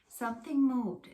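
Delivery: ordinary delivery, nothing marked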